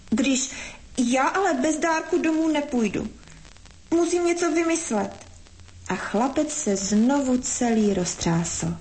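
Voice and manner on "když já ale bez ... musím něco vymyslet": put-on high, plaintive child's voice; unhappy small boy. A narrator's reading. Boy refuses to go home without a gift, high plaintive voice. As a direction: protest in a high, troubled little voice. Boy's voice in a story.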